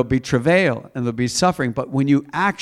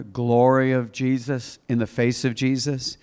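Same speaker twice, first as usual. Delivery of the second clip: ordinary talk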